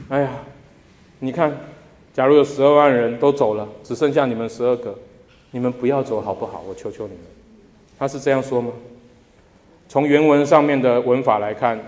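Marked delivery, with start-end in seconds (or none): 0.1-7.2 soft, begging plea fading to a hush; half-pleading. Pretend. Jesus imagined half-begging his twelve disciples to stay, soft pleading. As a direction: coax them softly not to leave, nearly begging. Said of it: "半哀求的语气".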